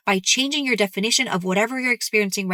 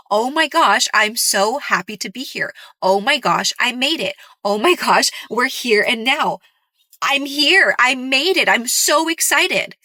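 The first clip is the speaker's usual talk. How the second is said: louder and higher, bursting with joy; excited cheering; 3 semitones higher, 4 dB louder than usual. Retold or imitated. Coach acting out reaching the manifestation, loud excited cheering. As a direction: shout the arrival like you just won. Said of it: "going to make you feel".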